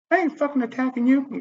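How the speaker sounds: very high mocking imitation; mock screaming woman. Woman denying she is attacking him, mock screamy voice. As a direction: mimic her yelling, pitched far up. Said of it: "She's sitting here yelling, screaming at me".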